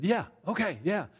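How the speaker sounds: man eagerly agreeing to the pastor's terms, animated; animated agreement. Retold or imitated. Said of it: "He was all animated".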